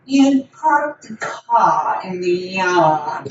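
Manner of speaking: slow, nasal English narrator impression; imitating a nasal English audiobook reader; nasal English accent. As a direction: drawl it out like a stuffy English audiobook. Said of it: "the guy is super nasally English".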